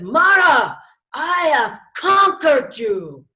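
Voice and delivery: loud slow defiant cry to the tempter; the Buddha shouting his conquest of Mara; triumphant shout. Acted. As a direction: proclaim victory over Mara, slowly. Siddhartha defies Mara.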